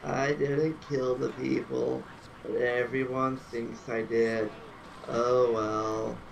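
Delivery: low, droning, resigned donkey voice; Eeyore. Acted. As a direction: sigh through it like a gloomy cartoon donkey. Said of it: "I can do an Eeyore voice".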